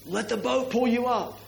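coaching yell from the boat. Retold or imitated. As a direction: shout it out to the skier. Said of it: "everybody in the boat will yell out".

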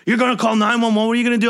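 high, agitated mock yell; retold yelling. Customer to rental worker over nine one one, mock yelling. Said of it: "Wally Backman starts yelling".

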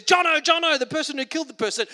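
high, loud call then rushed; mock policeman's call. Policeman calling out to a killer by name. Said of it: "a policeman just says".

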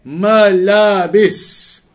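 Malabis said very slowly, syllable by syllable: ma-la-bis; slowly spelled-out word. Shown as a demonstration. Teacher sounding out Malabis slowly for the students. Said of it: "The word is there".

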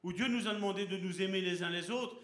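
usual speaking voice

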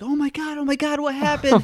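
high, alarmed relatives; mock panic. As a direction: cry out as if someone just collapsed.

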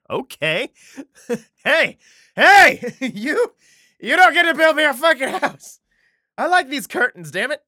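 high, loud indignant yelling; angry God. Acted. God telling David off about the house, angry yelling. Acts out God's angry refusal.

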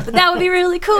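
high, loud eager counterargument; tempted inner voice. Retold or imitated. Tempted inner voice argues back.

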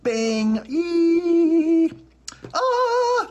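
sudden very high, loud squeal; tinnitus squeal imitation. Shown as a demonstration. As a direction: shriek like ringing in the ear. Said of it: "That's what it does".

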